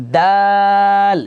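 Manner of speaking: long held model dal; drawn-out dal. Shown as a demonstration. Teacher stretching দাল slowly for the class to repeat. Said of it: "আমার সাথে সাথে বলবেন".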